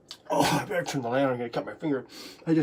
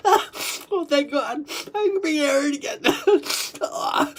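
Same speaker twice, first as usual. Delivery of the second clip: high, laughing cry of relief; tearful relief; 8 semitones higher than usual. Acted. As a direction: gasp thanks as if air just returned. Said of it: "'cause you're so glad".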